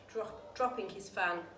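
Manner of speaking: ordinary delivery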